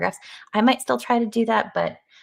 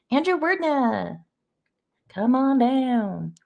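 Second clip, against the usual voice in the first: drawn-out, raised game-show summons; playful announcer call; 5 semitones higher, much slower than usual. Pretend. Host playfully welcoming a viewer to come on down, announcer call. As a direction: stretch each word like calling up a contestant.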